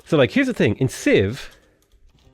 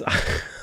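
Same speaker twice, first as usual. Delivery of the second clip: a quick, unvoiced chuckle; short breathy laugh; 6 dB quieter than usual. Real, not acted. Player amused by his own excuse, short laugh. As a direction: laugh briefly under the breath.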